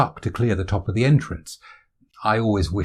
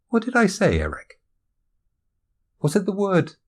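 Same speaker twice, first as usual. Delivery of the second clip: high-pitched questions to a dog; high, searching question voice; puzzled questioning; 11 semitones higher than usual. Acted. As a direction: pitch it up, quizzing the listener. Questions the dog about his word.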